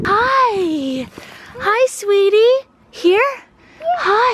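high, sweet puppy talk. Real, not acted. Mom coaxing a shy puppy closer, high baby talk.

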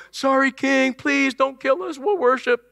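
high, whiny mock begging; hammed-up cringing plea for mercy; pleading. Three men imagined begging the king, high mock plea. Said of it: "expected these three guys to go".

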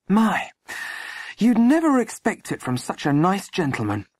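high-pitched astonished landlady; scandalised. A narrator's reading. Landlady disappointed in such a nice gentleman, scandalised. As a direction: marvel high-voiced in disbelief. Molly exclaimed.

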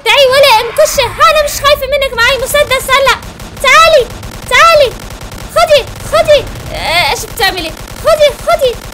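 loud, high battle yelling; all-out battle cries; fierce shouting. Real, not acted. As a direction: shout defiance while shooting. Shooting the boss, taunting.

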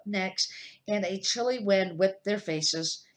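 plain reading voice

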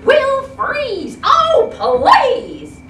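high, loud desperate plea; high pleading cry. Acted. Fish begging the elephant, high loud plea. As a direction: beg them not to, climbing high.

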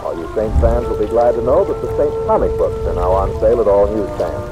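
normal talking voice

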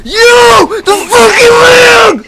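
high, loud angry yell; furious shout. Real, not acted. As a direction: blow up at the lag. Angry at death from lag.

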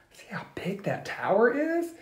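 hushed storytime aside; whispered aside. Real, not acted. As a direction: lean in and whisper it.

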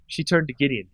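plain reading voice

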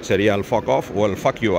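ordinary talk, nothing marked

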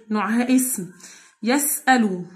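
normal talking voice

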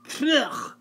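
high disgusted "pugh" spit. Acted. Wife spits out dressing.

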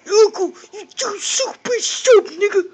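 very loud, high garbled squawk; hammed-up impression, squawked at full volume; mocking character voice. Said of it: "He's like".

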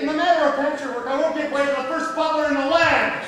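loud, insistent stand on principle; defiant protest. Acted. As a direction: stand your ground loudly. Servant refuses to give way.

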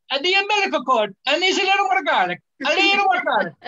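sing-song nonna stirring the pot; Italian cook voice. Retold or imitated. Newborn Italian baby demanding garlic, hammed-up high cook voice. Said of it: "they go".

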